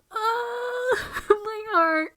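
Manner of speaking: very high, laughing, tearful squeal; overwhelmed squeal. Real, not acted. Moved by a protective line, a high squealing laugh. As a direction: let it break into a laugh, heart melting.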